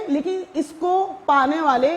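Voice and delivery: ordinary talk, nothing marked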